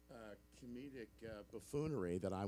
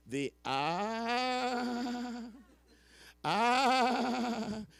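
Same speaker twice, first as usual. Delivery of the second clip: sigh laugh; 9 dB louder, much slower than usual. Retold or imitated.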